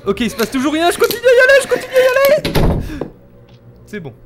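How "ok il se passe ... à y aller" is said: rising louder frightened push; nervous building to scared cry. Real, not acted. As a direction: force yourself on while panicking. Forcing himself forward scared.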